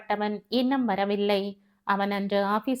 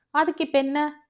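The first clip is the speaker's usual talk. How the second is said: higher, dismissive question; indifferent; 5 semitones higher than usual. A narrator's reading. Ramani answers Rangan about the ticket, indifferent. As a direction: shrug the matter off. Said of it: "லட்சியம் இல்லாமல் பேசுகிறான்".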